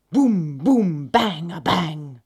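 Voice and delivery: loud, drawn-out drumbeat words; spoken drum banging; drum imitation.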